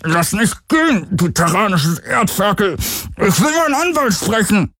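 high-pitched protest through a covered mouth; half-intelligible, gagged-sounding indignation; muffled protest. A narrator's reading. Galen fakes a captured stowaway's protest, muffled. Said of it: "hielt sich selbst den Mund zu und protestierte nur halb verständlich".

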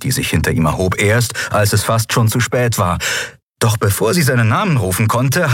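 plain reading voice

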